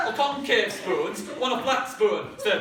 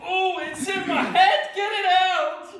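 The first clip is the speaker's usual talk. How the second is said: higher, louder frantic cry; panicked shout; 7 semitones higher, 3 dB louder than usual. Acted. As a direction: yell it like something's in your head. Acted alien in head.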